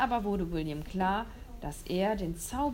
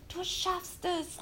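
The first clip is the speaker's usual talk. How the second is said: much higher, slow cheering call; a boy urging his friend to fly; 10 semitones higher, slower than usual. Acted. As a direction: cheer the dinosaur on. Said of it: "rief William".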